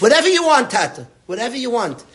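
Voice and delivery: high, bright-eyed daughter pleading to please; a daughter's high excited offer; eager daughter. Retold or imitated. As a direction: gush it like a loving daughter eager to give. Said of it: "Shir said".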